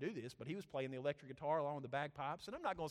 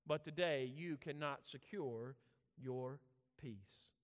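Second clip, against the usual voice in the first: ordinary talk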